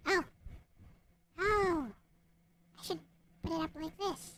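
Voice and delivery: usual speaking voice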